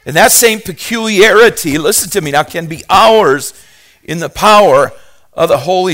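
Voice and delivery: loud, high fervent preaching; preaching at full force. Acted. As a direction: drive it home to the congregation.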